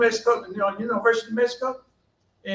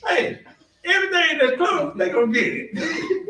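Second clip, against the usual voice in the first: laughing quote; 8 semitones higher than usual. Retold or imitated. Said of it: "He said".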